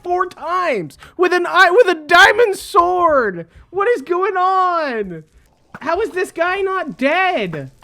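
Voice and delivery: high, loud and slowed, disbelieving; exasperated disbelief. Real, not acted. Gamer baffled the enemy won't die, high disbelief. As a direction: cry out in disbelief.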